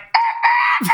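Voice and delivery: very high rooster squawk; imitated rooster crow. Rooster hitting six A M, high imitated crow.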